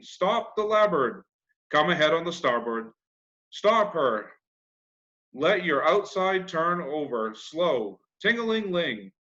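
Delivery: put-on a boy hamming up a steamboat, calling orders and ringing the bell; boy playing steamboat captain. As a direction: play captain and engine bell at once, shouting orders up high.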